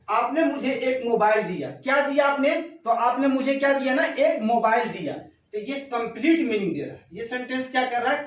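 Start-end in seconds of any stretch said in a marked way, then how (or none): none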